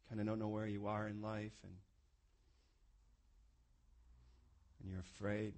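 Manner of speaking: soft closing prayer; quiet closing prayer. Acted. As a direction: pray gently over the room. Leads closing prayer softly.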